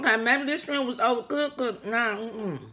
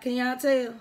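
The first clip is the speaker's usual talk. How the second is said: ordinary talk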